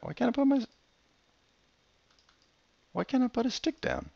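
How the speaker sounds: puzzled protest at the game; high, baffled complaint; high frustrated disbelief. Real, not acted. Frustrated at blocked placement.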